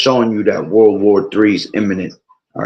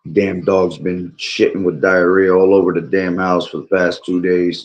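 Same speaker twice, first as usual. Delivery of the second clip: ordinary talk, nothing marked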